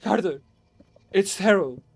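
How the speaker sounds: high, loud horrified exclamations; horrified friend calling from the vault; terrified cries. Acted. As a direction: shout up in panic.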